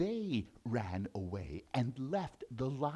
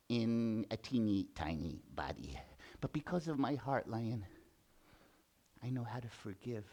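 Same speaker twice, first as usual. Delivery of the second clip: gentle mouse; 4 semitones lower, 6 dB quieter than usual. Acted.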